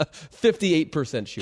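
breaking into a laugh mid-stat; real laughing. Real, not acted. Co-host can't read out Jokic's shooting percentage without laughing. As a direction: crack up, then get the number out through laughter. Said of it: "Can't even say it out loud without laughing".

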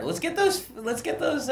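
squeaky mock troll. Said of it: "Be like".

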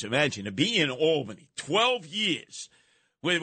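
ordinary delivery

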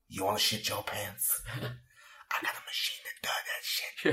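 hushed unvoiced whisper; secretive whisper. Hotel clerk secretly offering Tesla a machine, whispered. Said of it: "the guy in the hotel is like".

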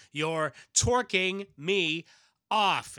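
drawn-out heroic yell; slow loud shout. Acted. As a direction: bellow it slowly. Valor bursts out of the mouth.

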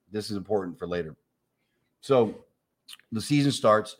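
normal talking voice

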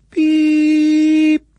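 high steady electronic beep; one long high beep; imitated beep tone. Imitating number station tone.

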